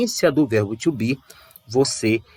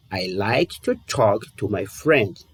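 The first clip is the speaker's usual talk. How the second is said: "I like to talk to my friends" again, slow and softer; slow softer repeat; 4 semitones lower, slower than usual. Shown as a demonstration. Teacher repeats the example slowly and softly for students. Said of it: "De novo".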